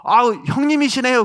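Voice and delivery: re-enacted glad greeting, high-pitched; delighted greeting. Preacher recalls greeting a fellow hometown man, delighted. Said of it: "내가 너무 반가워가지고".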